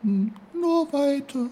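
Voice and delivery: high, stammering little flower voice putting on courage; brave snowdrop. Acted. The snowdrop bravely urges the uncle on. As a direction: brave face despite losing petals. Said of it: "sagte das Schneeglöckchen tapfer".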